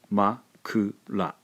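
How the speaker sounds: ma-ku-ra said slowly, beat by beat; slow syllables. Shown as a demonstration. Teacher sounding out the word makura slowly. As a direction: spell out ma-ku-ra syllable by syllable. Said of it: "pronounced ma-ku-ra".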